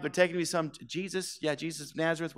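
sheepish, shrinking away; embarrassed mumble. Retold or imitated. Paralyzed man embarrassed, explaining to a passerby, fading mumble.

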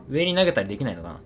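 usual speaking voice

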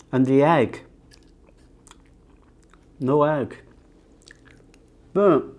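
speaking between bites; talking while chewing. Real, not acted. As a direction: mouth partly full of food. Seen 0: Food taken at -2.0, then chewing; new forkful at 4.2.

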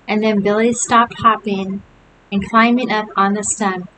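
ordinary delivery

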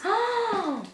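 sharp gasp of delight; surprised gasp. Real, not acted. Opening a friend's parcel, a delighted gasp. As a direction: catch your breath at the gift.

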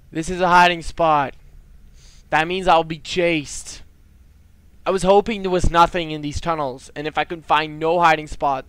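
loud, dismayed complaint; alarmed protest. Real, not acted. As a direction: protest loudly at being in danger. Realises he will be chased.

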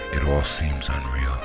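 ordinary delivery

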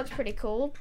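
ordinary delivery

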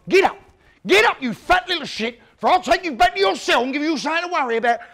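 furious guard. Retold or imitated. Brutal guard screaming at the fallen fat kid, furious. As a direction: bellow at the boy with contempt. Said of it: "he's gone".

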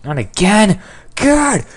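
high, loud exasperated cry; frustrated yell. Real, not acted. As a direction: wail at yet another setback. Frustrated at dying again in game.

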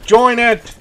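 booming motivational speech. Pretend. Rallying troops.

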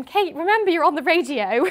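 scolding listeners. Retold or imitated. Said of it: "got loads of people going".

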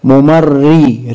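ممري stretched, ra doubled with kasra; slow syllable. Shown as a demonstration. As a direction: hold ممري to hear the shadda. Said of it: "عايزين نعرف شدة وضمة". (ممري ببطء)

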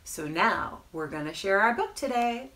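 usual speaking voice

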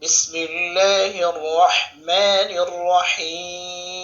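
slow, drawn-out chanted recitation; slow Quranic chant. Acted. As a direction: recite it slowly with melodic tajweed. Recites basmala.

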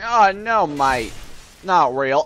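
hammed-up Australian scepticism; awful Australian accent. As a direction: wave it off in bad Australian. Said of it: "the worst accent ever".

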